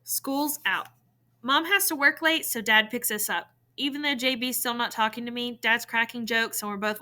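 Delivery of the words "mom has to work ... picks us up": ordinary delivery, nothing marked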